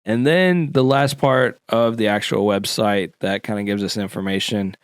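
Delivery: normal talking voice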